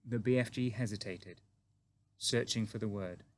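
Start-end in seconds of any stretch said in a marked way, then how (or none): none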